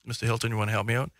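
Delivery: ordinary delivery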